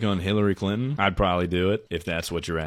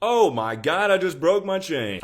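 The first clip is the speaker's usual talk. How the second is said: surprised outcry; 10 semitones higher, 4 dB louder than usual. Real, not acted. Chain snaps mid-talk.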